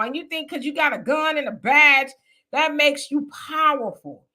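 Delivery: ordinary talk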